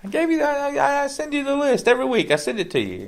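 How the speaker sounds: high, fast exasperated Italian nonna; Italian grandmother voice. Acted. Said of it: "You sounded very Italian grandmother out there".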